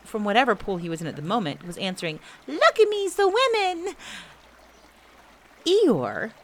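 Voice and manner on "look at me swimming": very high, slow, loud squeal; drawn-out high-pitched boast; squeaking Roo. A narrator's reading. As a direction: show off in a tiny joey voice. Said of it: "still bubbling proudly".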